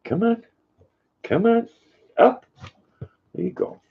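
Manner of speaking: high, soft sing-song coaxing of a dog. Real, not acted. Owner coaxing her dog Luna onto the chair, high cooing baby talk.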